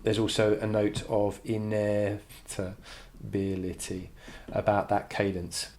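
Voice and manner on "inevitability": slow, syllable by syllable; drawn out. Shown as a demonstration. Teacher sounding out a word while writing it, drawn out. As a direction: stretch "inevitability" into separate syllables.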